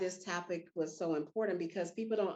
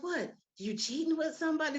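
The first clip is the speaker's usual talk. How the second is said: high, shocked gossiping friends; 7 semitones higher than usual. Retold or imitated. Woman retelling friends' shocked guess about cheating, high and scandalized. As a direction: mimic friends gasping at a scandal. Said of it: "when they was like".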